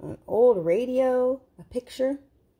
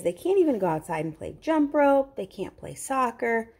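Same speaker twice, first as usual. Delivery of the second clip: ordinary delivery, nothing marked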